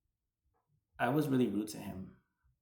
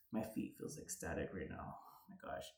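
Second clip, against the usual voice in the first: hushed whisper to herself; breathy aside under her breath; 6 semitones lower, 12 dB quieter than usual. Real, not acted. Vlogger whispering an aside to herself, hushed.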